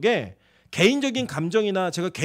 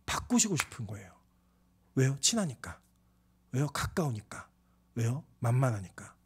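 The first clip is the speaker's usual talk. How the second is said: softer, lower rhetorical answers; hushed call-and-answer; 7 semitones lower, 9 dB quieter than usual. Real, not acted. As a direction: draw them in quietly.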